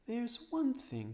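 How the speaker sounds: high, slow and thoughtful; Lucy raising a new idea; slow, high girlish start to a thought; eager girl. Acted. Lucy begins her idea.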